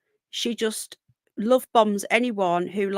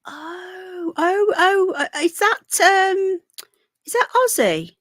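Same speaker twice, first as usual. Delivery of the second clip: startled cries, much higher, breaking into a cry; surprised; 9 semitones higher, 4 dB louder, slower than usual. Real, not acted. Spotting a familiar viewer in the chat, startled. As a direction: caught off guard by who has just joined.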